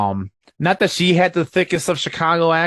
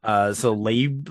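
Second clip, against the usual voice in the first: ordinary talk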